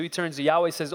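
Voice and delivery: usual speaking voice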